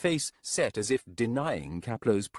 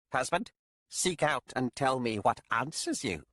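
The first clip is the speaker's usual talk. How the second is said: witch voice; 5 semitones higher than usual. Acted. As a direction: speak as a cold, proud witch.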